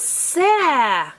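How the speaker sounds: "sa" stretched slowly, vowel held; drawn out. Shown as a demonstration. Teacher showing a child a sound, drawn out. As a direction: stretch "sa" into one long slow sound. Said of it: "say the word slowly".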